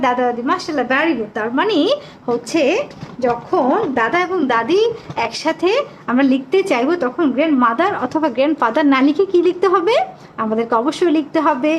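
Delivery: usual speaking voice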